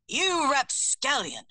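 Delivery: slow, indignant little British bird spitting the word out; a touch of outraged prim hummingbird; deep spat insult. Acted. Narrator as Atticus spitting at the cat, slow mock outrage. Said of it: "he spat in his uncharacteristically deep voice".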